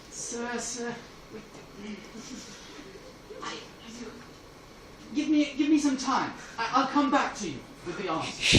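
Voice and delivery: hesitant stammer with long pauses; flustered stalling. Acted. Helms has no answer for his president, flustered. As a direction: stall haltingly, then plead for time.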